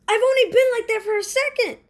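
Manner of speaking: high, loud disbelief; high squeaking shout of shock; shocked outburst. Acted. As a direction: cry out in disbelief. Shocked at the loss.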